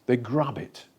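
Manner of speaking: guided meditation. Real, not acted. Speaker leading a group through a visualisation, guided meditation voice. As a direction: guide listeners through an imagined scene, phrase by phrase.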